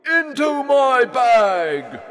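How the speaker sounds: very high, loud, slow command; monster shout. A narrator's reading. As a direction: drag out the order. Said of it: "shouted the monster".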